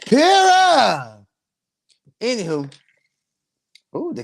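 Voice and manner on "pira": loud, high exclaimed Pira; loud exclamation. Real, not acted. Host exclaiming to the live chat, loud.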